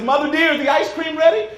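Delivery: loud, high-pitched call to grandmother; hollering. Retold or imitated. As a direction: holler it across to another room. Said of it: "I hollered out at my grandmother".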